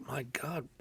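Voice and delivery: hushed whisper to himself. Retold or imitated. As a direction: whisper it in private shock. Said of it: "I used to think to myself".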